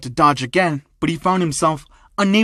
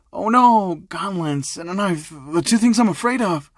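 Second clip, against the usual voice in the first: mock fear, pitched up; very sarcastic; 4 semitones higher than usual. Izuku mocks the thugs' gauntlets and knife, sarcastically. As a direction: feign dread with swooping "Oh no". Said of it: "very sarcastically, would say".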